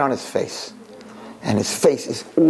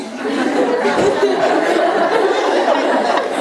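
high burst of real laughter; 3 dB louder than usual. Real, not acted. Preacher cracking up at his own story, laughing high. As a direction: laugh hard at the punchline.

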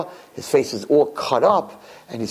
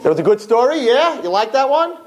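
upbeat question; 11 semitones higher, 4 dB louder than usual. Real, not acted. Asks audience if they liked it.

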